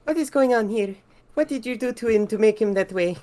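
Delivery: very high, put-on woman's voice; Francesca. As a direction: question him in a high, upset voice. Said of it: "And Francesca asks".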